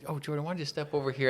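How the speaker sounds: quick, soft loving nudge; a lightly voiced gentle request; mock loving. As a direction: sweetly shoo her aside, softly. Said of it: "you know, lovingly".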